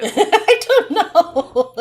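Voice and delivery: words squeezed out through high loud laughter; can't stop laughing while answering; laughing hard. Real, not acted. Cracking up at her daughter's quiz joke, high laughing reply.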